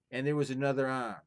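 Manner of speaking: lively storyteller reading a folktale aloud; story narration. A narrator's reading. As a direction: tell it like a fireside tale, full of drama. Reads folktale aloud to viewers.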